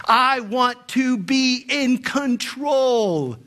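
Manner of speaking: mock insistence. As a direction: stretch out each word like a stubborn demand. Mimics people demanding control over life.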